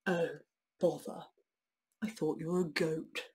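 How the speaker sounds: mild troll disappointment; lightly voiced troll. Troll let down it's not a goat, mildly gruff. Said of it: "said the troll".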